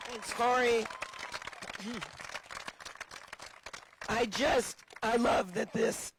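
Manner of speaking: losing her voice, hoarse; strained and hoarse from shouting. Real, not acted. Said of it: "I'm losing my voice out here".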